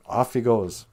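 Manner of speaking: ordinary delivery, nothing marked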